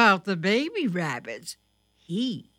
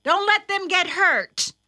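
high, raised motherly voice; mother's warning; 11 semitones higher, 5 dB louder than usual. A narrator's reading. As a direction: urge your son to take care. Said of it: "said his mother".

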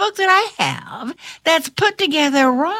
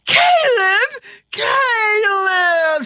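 shrieking; 10 semitones higher, much slower than usual. Acted. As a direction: shriek Caleb! with joy. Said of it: "she shrieked".

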